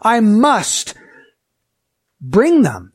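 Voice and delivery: "must" stretched, then a long pause; stress on MUST. Shown as a demonstration. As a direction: land on "must", wait, then "bring them". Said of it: "emphasizing certain words".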